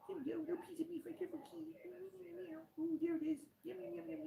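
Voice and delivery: very high, soft cooing pet voice; baby talk to cat. Acted. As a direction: coo at the kitty like a baby. Cooing while feeding cat.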